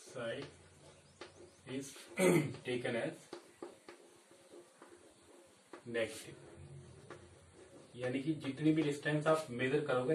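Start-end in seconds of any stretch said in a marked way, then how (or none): 0.1-6.3 slow note dictation. Acted. Teacher dictating notes slowly.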